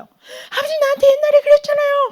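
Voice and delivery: acted-out wail, very high-pitched and loud; wailing reproach. Son bawls at his father over the past, wailing reproach. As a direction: cry out an old hurt like a bawling child. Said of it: "그 아들이 펑펑 울어요".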